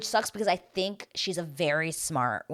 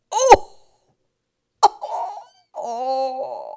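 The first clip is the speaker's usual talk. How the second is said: high, loud drawn-out "oh"; mock-scandalized high holler; hyped reaction whoop; 8 semitones higher, 12 dB louder, much slower than usual. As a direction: whoop like the crowd at a savage comeback. Acting out own amazed reaction.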